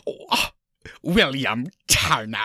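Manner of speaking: slow, drawn-out villain impression; Davy Jones. Host acting the villain seeing Will return, slow impression. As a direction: stretch out the name in the villain's accent. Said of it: "and he's like".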